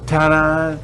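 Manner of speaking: bright stage ta-da; showbiz ta-da. Retold or imitated. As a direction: sell the reveal. Family's showbiz finish, redone.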